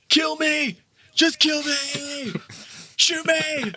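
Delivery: high mock wail of a dying soldier; a wounded soldier begging his comrade, mock screamed; retold scream. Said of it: "he's screaming to this other soldier".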